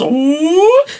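high whooping slide-whistle sound; falling whistle. Shown as a demonstration. Mimicking the radio sound effect of a fall. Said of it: "sound effects for people falling down with the little whistles".

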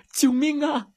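high, loud panicked cry; cry for help. A narrator's reading. Frightened friend screams for help at the bear.

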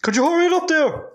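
high hurrying heckle; hammed-up rushing the speaker; mock impatient heckle. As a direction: podcasters rushing the eulogy, high.

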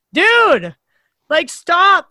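exasperated plea. Retold or imitated. Imagining angry partner at the game, exasperated plea. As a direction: plead for them to quit, pushed up. Acting out fed-up reaction to partner.

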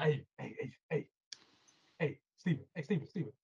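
low, soft imitation of an inner voice; hushed coaxing call. Alter ego calling to Stephen, low and hushed. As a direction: call softly for his attention, repeating it.

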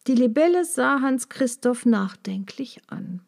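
plain reading voice

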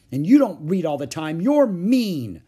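loud, high accusation; cross child's yell, building at the end; shouting. A narrator's reading. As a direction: shout it at your sibling. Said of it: "shouted Ramona".

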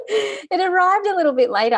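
words bubbling with laughter; giggling while explaining; laughing. Real, not acted. Telling her co-host the gift came late, laughing.

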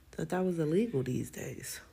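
sly whispered aside. Real, not acted. Whispering a sarcastic aside to listeners. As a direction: whisper it like a secret joke. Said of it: "sarcastic aside".

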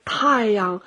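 ordinary delivery

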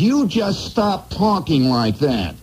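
slow, then high-pitched prissy outburst; mock-tough threat rising up high; mock holler. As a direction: play a timid guy trying to sound tough. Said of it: "holler to another guy".

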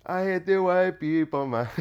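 mock Jamaican lilt; Jamaican accent. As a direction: try out a put-on Jamaican accent. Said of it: "what would a Jamaican sound like?".

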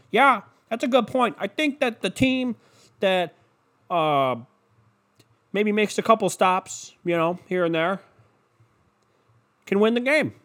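high, halting mock analyst; hammed-up pundit groping for a take; pregame show pundit. Analyst agreeing with colleague on pregame show, mock-earnest. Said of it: "just the takes just rolling over every hour".

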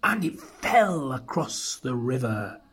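plain reading voice

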